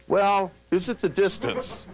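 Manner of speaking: high, slow, unsure questioning voice; dithering client. Retold or imitated. Client stalling instead of checking, high and hesitant. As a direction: play a confused client fumbling. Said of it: "they don't do things like".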